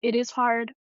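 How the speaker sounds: real, mildly choked-up confession; getting emotional. Real, not acted. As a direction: admit the struggle, emotion creeping into the voice. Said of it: "I'm sorry if I'm getting emotional".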